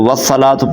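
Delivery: hurried, unchanted والصلاۃ; mispronounced recitation. Shown as a demonstration. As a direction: say والصلاۃ quickly as "wassalatu", with the waw dropped. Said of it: "تو واو حذف ہو جائے گا".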